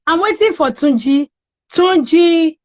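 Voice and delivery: normal talking voice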